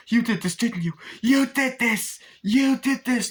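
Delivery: high, loud panicked cry of blame; imitating a hysterical wife screaming at her husband; terrified accusation. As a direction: shriek the accusation at him in horror. Wendy accusing Jack in terror.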